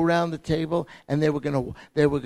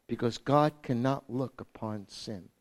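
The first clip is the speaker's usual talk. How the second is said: ordinary talk, nothing marked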